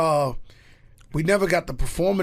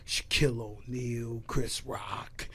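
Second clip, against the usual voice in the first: whispered name; 5 semitones lower, 9 dB quieter, slower than usual. Real, not acted. Name-dropping celebrities.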